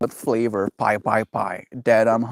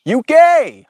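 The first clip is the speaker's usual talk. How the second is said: shouts U K very high and loud; excited shout; 9 dB louder than usual. Real, not acted. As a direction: yell it out excited.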